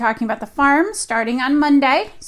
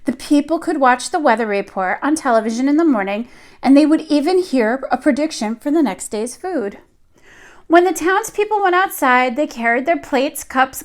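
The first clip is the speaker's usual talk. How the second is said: put-on lively read-aloud voice for young children; storybook reading; 3 semitones higher, 4 dB louder than usual. A narrator's reading. Teacher reading a picture book to her class, lively storytelling. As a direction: tell the tale to the class as if they sit on the rug.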